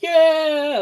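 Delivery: a sudden high whoop; mock partygoer yelling yeah; crowd cheer. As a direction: cheer like a happy casino crowd. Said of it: "everybody's, like".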